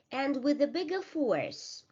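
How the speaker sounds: ordinary talk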